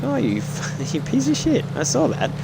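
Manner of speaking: high, laughing fake outrage; laughing mock outrage. Laughing at a teammate who stole his kill. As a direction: laugh it out in a squeaky mock scold. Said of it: "I'm like".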